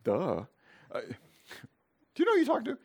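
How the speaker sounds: Jesus answering his mocking disciples with mock indignation; playful exasperation. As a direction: tease them like they should know better. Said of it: "Jesus kinda looking like".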